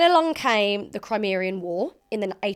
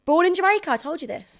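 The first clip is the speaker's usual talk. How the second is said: mock exasperated; 8 semitones higher, 5 dB louder than usual. Real, not acted. Answers a question already answered.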